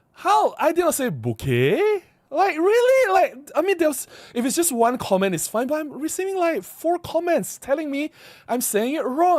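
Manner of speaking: high-pitched indignant protest; exasperated disbelief. Real, not acted. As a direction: argue back, baffled by the criticism. Protesting comments that say he is wrong.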